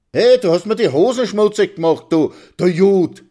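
high, very loud accusing yell; angry shouting. A narrator's reading. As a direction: shout the insult in a rage. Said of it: "schreit Karl und wirft sich auf Maxl". (wütend schreiend)

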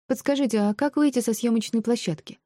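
high-pitched polite inquiry; polite young heroine. A narrator's reading. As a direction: stop strangers with a high, courteous question. Heroine asks passers-by for directions.

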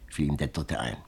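ordinary delivery, nothing marked

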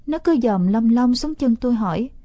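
ordinary delivery, nothing marked

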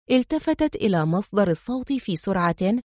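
usual speaking voice

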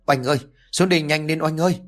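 high-pitched, hurried calling out; narrated urgent shout. A narrator's reading. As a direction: call for help fast, without real volume. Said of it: "hắn hét lớn".

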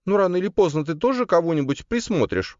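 very high, smiling sister voice; Карина. A narrator's reading. Sister teasing her brother about marriage, high smiling voice. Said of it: "улыбнулась Карина".

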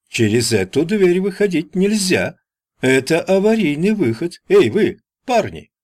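high, urgent salesman voice; salesman's high call. A narrator's reading. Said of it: "воскликнул мистер Пиперс".

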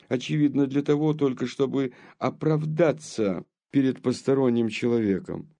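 ordinary delivery

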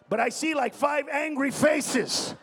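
normal talking voice

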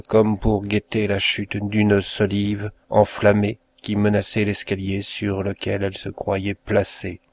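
plain reading voice

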